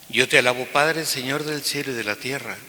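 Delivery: usual speaking voice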